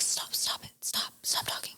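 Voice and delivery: hushed, pleading whisper; a husband whispering urgently to his wife to stop talking. Retold or imitated. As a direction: whisper to shut her up. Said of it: "looking at me like".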